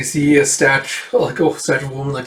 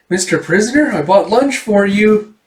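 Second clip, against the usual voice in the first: put-on girlish call; 7 semitones higher, 5 dB louder than usual. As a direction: sing it out sweetly to a stranger. [name] calls to the prisoner.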